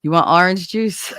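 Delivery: laughing Jersey "orange juice"; Jersey question ending in a laugh; Jersey accent. Shown as a demonstration. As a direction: show off Jersey "orange", amused. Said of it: "I say it orange".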